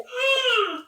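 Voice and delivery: high, loud mock waking groan; reluctant waking noise. Showing how other people rise up, mock strained groan. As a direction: imitate dragging yourself up with a noisy strain.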